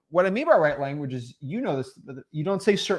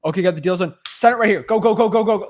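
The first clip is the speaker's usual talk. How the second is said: loud, higher, mock high-pressure urging; pushy closer; 5 semitones higher, 7 dB louder than usual. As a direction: hustle them to sign, hammering out each word. Imitates a pushy closer rushing sellers.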